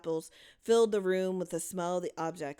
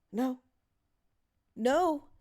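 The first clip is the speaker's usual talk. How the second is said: higher, breathless gasping no; gasped; 7 semitones higher, 4 dB louder than usual. A narrator's reading. Louise gasping in dread at the lights, breathless. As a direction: gasp it out in dread. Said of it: "Louise gasped".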